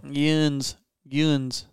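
Southern drawled yuns. Shown as a demonstration. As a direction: say Yuns the Southern way, one syllable. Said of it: "Yuns, y'all".

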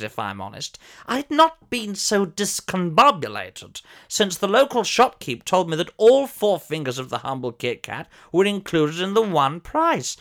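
normal talking voice

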